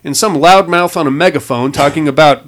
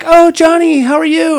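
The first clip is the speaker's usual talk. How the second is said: much higher, sing-song greeting; mock-sweet ex-girlfriend greeting Johnny; Sarah's voice; 4 dB louder than usual. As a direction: put on a high, sweet voice for the ex-girlfriend.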